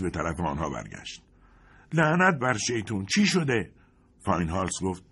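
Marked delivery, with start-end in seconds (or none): 1.9-3.7 higher, loud swearing question; cursing sergeant. Acted. Sergeant crawling back after the blast, angry curse. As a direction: curse and demand what happened.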